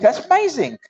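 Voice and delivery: high, delighted little wow; impressed vicar. Acted. Vicar marvels at the singing parrot, high. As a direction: marvel like a prim old vicar. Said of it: "says the vicar".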